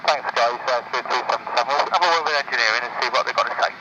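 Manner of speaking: pilot radio. Real, not acted. Pilot answering tower over radio.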